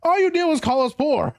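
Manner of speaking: high, teasing woman's voice; mock complaining woman. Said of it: "She was like".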